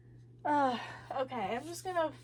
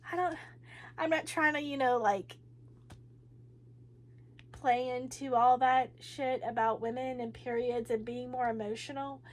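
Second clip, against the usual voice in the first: ordinary talk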